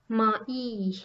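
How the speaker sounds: "mai" as two syllables, ma-EE; vowels pronounced separately. Shown as a demonstration. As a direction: split "mai" into a and a long ee. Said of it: "it is pronounced".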